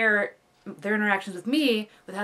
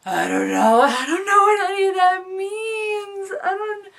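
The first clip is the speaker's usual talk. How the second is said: high, slow whimpering whine; mock panicked whine; 11 semitones higher, 6 dB louder than usual. Venting to a friend, high whimpering whine. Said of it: "it was fun to just be like".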